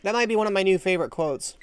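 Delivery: ordinary delivery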